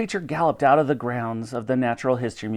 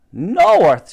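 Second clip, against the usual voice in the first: loud, higher burst of surprise; exclaimed; 5 semitones higher, 7 dB louder than usual. A narrator's reading. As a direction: exclaim it like a sudden discovery. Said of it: "she exclaimed".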